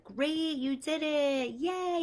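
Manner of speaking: cheering teacher. Retold or imitated. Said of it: "the teacher's like".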